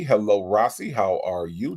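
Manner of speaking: ordinary talk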